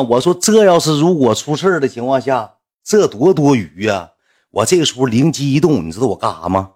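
ordinary talk

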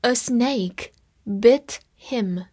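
very slow, careful reading; deliberate teacher pace; slow read-along. Shown as a demonstration. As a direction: stretch each word for learners. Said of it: "我们慢慢来读".

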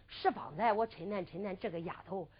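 hushed storyteller's inner monologue; secret inner thought. A narrator's reading. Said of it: "暗暗地想到".

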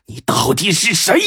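high, rasping plea; hoarse cry. Acted. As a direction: force it out hoarsely through pain. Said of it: "汪直也嘶声说道".

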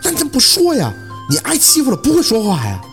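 high-pitched, exasperated reproach; agitated scolding. A narrator's reading. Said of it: "宝四还急了". (着急地高声责问)

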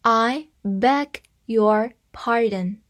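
slow, careful reading. Shown as a demonstration. Teacher reading a phrase slowly for the class. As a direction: read it slowly for learners to follow. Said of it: "我们慢慢来把它读一遍".

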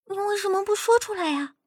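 high-pitched hushed protest; urgent reproach. Acted. Friend reproaches him for hiding injury.

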